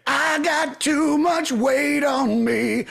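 hammed-up diva complaint; mock whine. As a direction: lampoon the singer with a drawn-out whine. Mocking the singer's lyrics.

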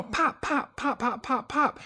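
high, light popping noises; small high-pitched mouth pops; imitated little snaps. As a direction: pop out tiny explosive snaps. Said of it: "it's little snaps".

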